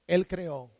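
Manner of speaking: ÉL stressed and said for the class to repeat; teacher's model phrase. Shown as a demonstration. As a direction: say Él creó loud and clear for the class to repeat. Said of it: "Repite conmigo".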